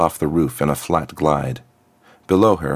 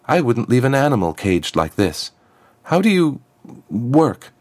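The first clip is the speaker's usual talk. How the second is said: helpless demand; 6 semitones higher than usual. Acted. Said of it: "she demanded helplessly".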